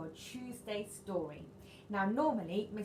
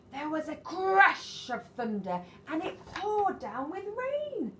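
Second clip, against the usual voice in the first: dramatic storm; 8 semitones higher, 7 dB louder, slower than usual. A narrator's reading. Sudden storm.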